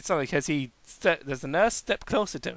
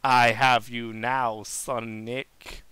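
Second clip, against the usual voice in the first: low, slow villain reveal; strange different tone; 8 semitones lower, much slower than usual. Acted. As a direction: drop into a slow, sinister voice, fading on the name. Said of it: "in a strange, different tone".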